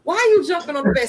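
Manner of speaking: a mother scolds her son for jumping on the bed; scolding. Retold or imitated. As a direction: scold the kid with mock exasperation.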